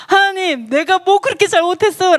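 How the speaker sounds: high-pitched plea of innocence; aggrieved protest. Acted. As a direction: protest to God as if wronged. Acts out Job protesting to God. (억울한 듯 높은 목소리로 항변)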